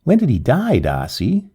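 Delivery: ordinary delivery, nothing marked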